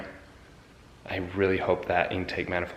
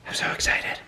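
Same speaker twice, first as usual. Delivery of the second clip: excited whisper; 4 dB quieter than usual. Real, not acted. Thrilled aside while installing transmission.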